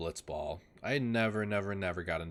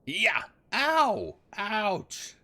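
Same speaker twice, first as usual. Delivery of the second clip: high loud yelp of pain; cartoon battle cry then loud ouches; game character yelps; 13 semitones higher, 9 dB louder, much slower than usual. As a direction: yell it out, sharp and high. Acting out game characters' yells.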